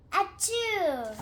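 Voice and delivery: big loud acted achoo; loud sneeze. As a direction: sneeze it out hard. Gerald sneezes at cat.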